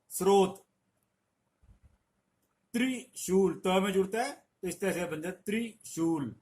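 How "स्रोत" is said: स्रोत stressed and louder, the s running straight into r; स्रोत, said as srot with no t before r. Shown as a demonstration. Teacher stresses the correct स्रोत to the class. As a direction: say स्रोत clearly as s-r-ot, no extra t. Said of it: "ध्यान दीजिए".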